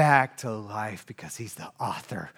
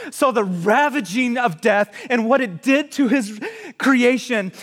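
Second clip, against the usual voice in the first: loud, high, impassioned preaching; preaching at full force; 7 semitones higher, 10 dB louder than usual. Acted. Pastor preaching the passion story to the congregation, at full force. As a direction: lift the whole room with it.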